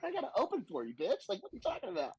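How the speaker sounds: high-pitched incredulous retort, laughing; mock outrage. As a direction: play indignant disbelief, breaking into a laugh. Mock outrage at nervous headliner.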